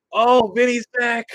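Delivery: excited. Real, not acted. Greeting returning viewer.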